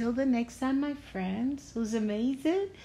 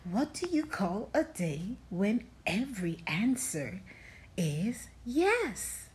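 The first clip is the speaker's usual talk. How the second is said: soft secret whisper, lower; whispered riddle; 3 semitones lower, 6 dB quieter than usual. Acted. Storyteller whispering a riddle to young listeners. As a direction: lean in and whisper the question.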